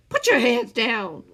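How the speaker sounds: loud, slow command; booming order. A narrator's reading. The man ordering Scar's hands down, booming. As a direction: boom it at him. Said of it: "The man's booming voice".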